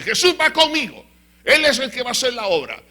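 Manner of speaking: high, loud cry of faith; defiant declaration. Acted. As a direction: declare it boldly at full voice. Father declares his defiant faith. (declaración de fe a plena voz)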